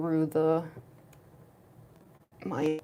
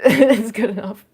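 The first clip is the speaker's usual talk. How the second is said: laughing; 3 semitones higher, 12 dB louder, slower than usual. Real, not acted. Laughing at own makeup.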